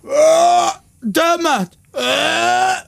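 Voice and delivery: high retching gag around Dermot; retch, then call Dermot. Shown as a demonstration. Host demoing the phone answer, mock retching call. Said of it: "answer your phone by saying the following".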